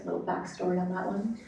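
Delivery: ordinary delivery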